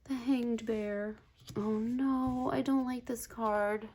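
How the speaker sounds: sorry for the poor creature; pitying, gently upset; saddened. Real, not acted. Dismayed at hanged bear card.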